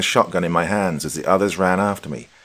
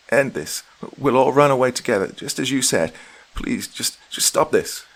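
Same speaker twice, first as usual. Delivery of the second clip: desperate begging; 7 semitones higher than usual. Acted. Wife begging him to stop.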